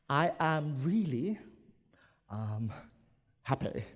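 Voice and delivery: slow, halting really, um, happy; sheepish. Shown as a demonstration. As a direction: hesitate shyly before happy. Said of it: "this happy here has a bit of sheepishness".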